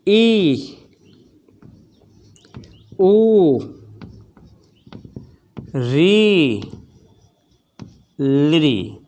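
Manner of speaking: each vowel held long, slow; drawn-out vowels. Shown as a demonstration. Teacher to students, stretching each vowel long. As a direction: stretch every vowel like a chant. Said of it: "तो देखिए".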